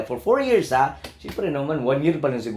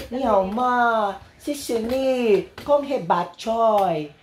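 slow, sing-song mock Chinese, pitched up; mock Chinese accent; 7 semitones higher, much slower than usual. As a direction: drawl it out like a playful Chinese imitation. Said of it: "Kung Chinese ka".

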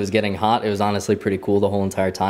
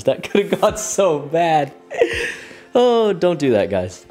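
giddy laughing; 8 semitones higher, slower than usual. Real, not acted. Giddy laughter after stunt.

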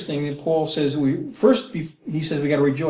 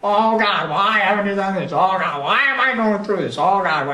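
higher, louder whiny plea to God; mock grumbling prayer, raised and louder; whining prayer; 4 semitones higher, 3 dB louder than usual. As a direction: complain to God like a spoiled child. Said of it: "similar to grumbling and complaining".

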